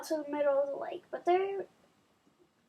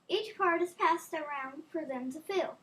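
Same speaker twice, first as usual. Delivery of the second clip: ordinary delivery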